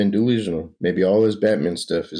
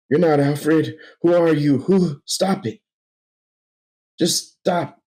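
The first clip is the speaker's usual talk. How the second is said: much higher, louder furious cries; desperate shout; 9 semitones higher, 3 dB louder, slower than usual. Acted. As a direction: rage and terror at the impostor. Bruce confronts false Alfred.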